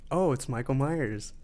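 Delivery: ordinary talk, nothing marked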